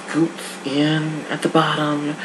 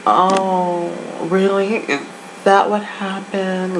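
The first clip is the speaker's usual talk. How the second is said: a touch of fake sympathy; 7 semitones higher, slower than usual. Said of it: "Paris was like".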